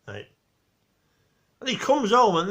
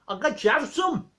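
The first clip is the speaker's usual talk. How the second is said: put-on Geordie accent; 8 semitones higher than usual. Geordie guy selling Victor eggs, thick Geordie accent. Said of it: "couldn't understand his accent".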